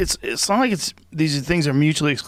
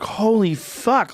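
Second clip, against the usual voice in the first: much higher, slow, drawn-out amazed oath; stunned; 8 semitones higher, much slower than usual. Retold or imitated. Host hearing the guest's grandpa was Barney Hill, slow stunned oath. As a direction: let the shock stretch out slowly. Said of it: "And I was like".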